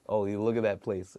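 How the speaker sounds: plain reading voice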